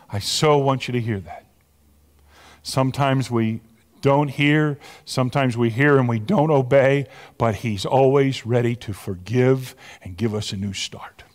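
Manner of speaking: ordinary talk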